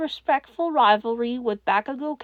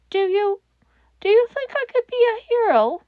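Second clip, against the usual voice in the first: put-on timid child; 8 semitones higher, 4 dB louder than usual. A narrator's reading. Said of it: "child asks hopefully".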